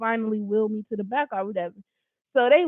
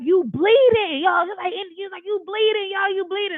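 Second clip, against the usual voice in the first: higher, urgent mock alarm; 8 semitones higher than usual. Boyfriend spotting her wound, higher alarmed retelling. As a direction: echo his shock as he spots blood.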